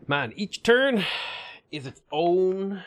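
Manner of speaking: slow, high, drawn-out lament; dramatic voice. Pretend. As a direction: stretch it out like a tragedy. Dramatic complaint.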